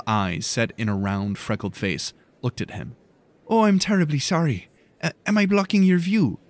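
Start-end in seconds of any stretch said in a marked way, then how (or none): 3.5-6.4 put-on high, apologetic young Irish girl's voice; a flustered Irish lass, pitched up high; girl says sorry to the boy in a gentle Irish lilt; soft Irish girl. A narrator's reading. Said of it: "in a soft voice with a strong Irish accent".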